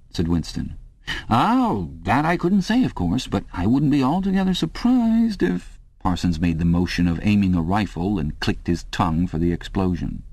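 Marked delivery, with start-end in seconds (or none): 1.3-5.8 high, relishing bluff voice; hint at the grim end with relish; higher knowing chummy tone; knowing hearty neighbour. A narrator's reading. Parsons voiced in story.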